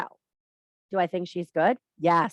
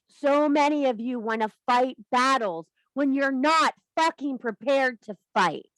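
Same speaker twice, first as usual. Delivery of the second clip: usual speaking voice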